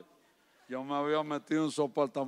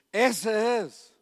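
calling out; 7 semitones higher, 8 dB louder than usual. Retold or imitated. Woman calls out in church.

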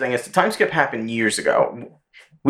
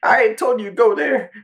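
angry yell; 12 semitones higher than usual. Retold or imitated. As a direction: bark it at a subordinate. Imitating Akainu yelling from office.

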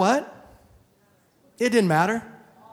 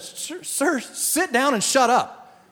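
higher, slow cop command; frustrated cop order; 9 semitones higher, much slower than usual. Retold or imitated. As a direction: bark the order slowly. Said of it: "And so I just said".

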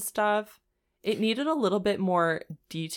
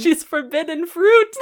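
laughing, slow mock line; laughing through it; 9 semitones higher, 10 dB louder than usual. Friend laughing while mocking the men. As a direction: giggle out the words.

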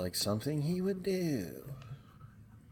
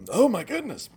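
choking on a drink; coughing, choked up; 9 semitones higher, 8 dB louder, faster than usual. Real, not acted. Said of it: "Choked up?".

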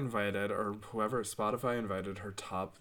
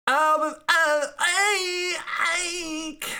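loud, very high, drawn-out singer impression; 9 dB louder, much slower than usual. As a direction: belt it out slowly in an exaggerated high voice. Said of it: "impression of this week's artist".

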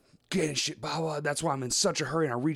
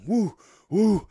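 higher, louder whooping; mock yell; 7 semitones higher, 6 dB louder than usual. Hurling buckets in a rage, whooping loudly. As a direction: whoop as you hurl them.

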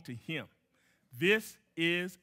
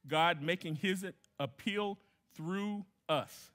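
slow reading; slower than usual. Shown as a demonstration. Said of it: "it says".

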